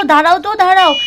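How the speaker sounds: irritated call. Acted. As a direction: tell the noisy visitor to stop. Annoyed at honking. (বিরক্ত হয়ে জোরে ডাক)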